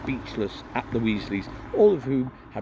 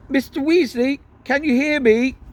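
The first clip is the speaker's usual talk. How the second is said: higher, louder call; calling through boards; 8 dB louder than usual. Acted. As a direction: call out so he hears through wood. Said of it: "called through the boards".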